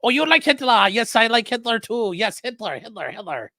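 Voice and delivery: high, loud hammed-up mock German voice; taunting fake German accent, pitched high; mocking German caller. Radio guy mocking a German ham, loud mock voice. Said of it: "talking to him was like".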